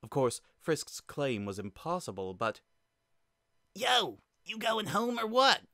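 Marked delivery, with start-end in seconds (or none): 3.7-5.6 put-on loud, high kid call; shouting. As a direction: call across the stage. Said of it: "Monster Kid shouted".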